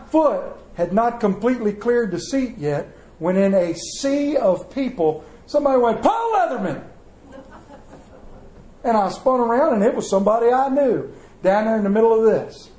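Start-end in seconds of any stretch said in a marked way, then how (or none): 6.0-6.8 calling out a name. Retold or imitated. Someone in the crowd calling out to the pastor by name. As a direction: shout his name to catch him in the crowd.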